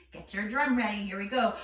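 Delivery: normal talking voice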